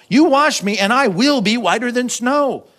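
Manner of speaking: high, slow, ringing conviction; emphatic assurance. Acted. David answering doubt before God, emphatic and sure. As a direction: declare it boldly, stretching each phrase.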